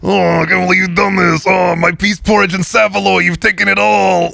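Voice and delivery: high, shouted Cockney villain wail; [name] as Oliver and Company villain. Acted. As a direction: wail like a cartoon London villain. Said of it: "sounding like the villain from Oliver and Company".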